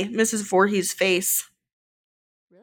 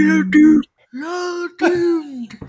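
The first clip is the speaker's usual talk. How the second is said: slow, higher ominous drawl; ominous doom prophet; 7 semitones higher, 6 dB louder, much slower than usual. Retold or imitated. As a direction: wail the doom warning slowly.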